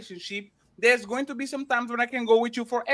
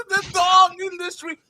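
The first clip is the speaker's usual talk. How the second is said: higher and louder fake blubbering; mock crying; 7 semitones higher, 5 dB louder than usual. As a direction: sob out the words like a man crying over a puppy. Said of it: "and I was like".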